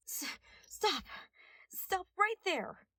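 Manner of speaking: high, breathless call to stop; a young detective yelling stop. A narrator's reading. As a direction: shout after him, winded from running. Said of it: "a young voice called out".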